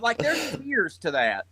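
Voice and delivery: ordinary delivery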